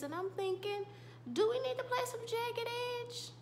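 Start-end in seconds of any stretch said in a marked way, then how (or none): 1.3-3.3 excited squeal. Real, not acted. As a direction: gush in a high-pitched voice. Excited aside, calls for a love song.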